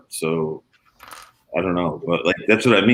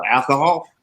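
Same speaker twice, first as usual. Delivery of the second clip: imitated Southern accent, pitched up; 5 semitones higher than usual. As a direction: echo it with a mock Southern accent. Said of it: "like he was from the South".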